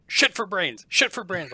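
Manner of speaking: high, loud yapping insults; barking creatures. Acted. Caged creatures barking insults at the player, high yapping. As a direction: bark the insult like a small beast. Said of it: "they start barking at you".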